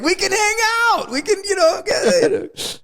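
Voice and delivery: high, eager mock voice fading to breath; eager friend. Gangster eager to befriend the author, high and eager. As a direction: pitch it like a lonely fan.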